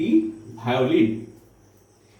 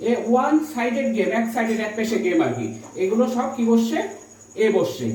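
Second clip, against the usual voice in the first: ordinary talk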